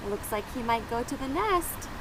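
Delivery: plain reading voice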